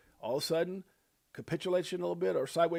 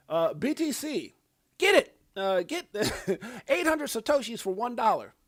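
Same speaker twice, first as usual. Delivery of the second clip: mock Bitcoin fan; 7 semitones higher, 5 dB louder than usual. Mocking Bitcoin fans' boast.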